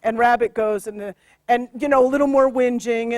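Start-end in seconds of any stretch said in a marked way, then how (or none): none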